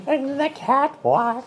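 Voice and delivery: granny voice. Pretend. Granny voice play.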